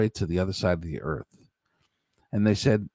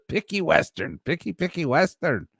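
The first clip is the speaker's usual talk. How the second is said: usual speaking voice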